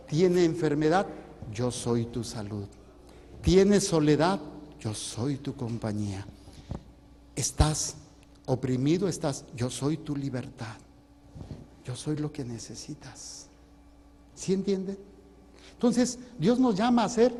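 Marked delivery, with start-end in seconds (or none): 1.5-13.5 softer and lower, gentle promise; tender divine reassurance. Acted. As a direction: whisper comfort to someone oppressed. God's answers, soft and tender. (voz de Dios, suave, grave y tierna)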